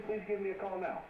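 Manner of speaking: normal talking voice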